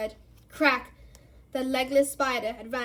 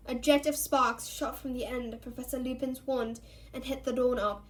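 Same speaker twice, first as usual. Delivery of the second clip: usual speaking voice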